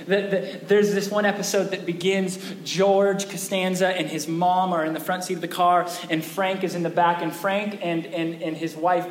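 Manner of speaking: usual speaking voice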